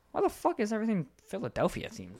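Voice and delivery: high-pitched baffled outburst; incredulous retold question; baffled disbelief. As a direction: replay your bewildered reaction, pitch jumping up. Reacting to Target's team merchandise.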